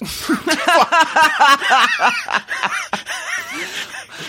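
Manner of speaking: long, high burst of laughter; helpless high-pitched cackling; big laugh. Real, not acted. Co-host tickled by the linguistics building joke, laughing hard.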